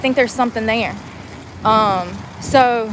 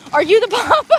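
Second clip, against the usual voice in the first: mock question; 8 semitones higher, 4 dB louder than usual. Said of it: "and be like".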